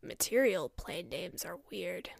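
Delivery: deadpan it low; low quiet man voice. Acted. Forest man comments.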